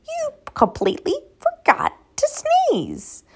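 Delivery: high, loud squeaky mouse voice; a little mouse cheekily scolding the elephant; cheeky mouse. Acted. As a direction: tease the big elephant in a tiny high voice.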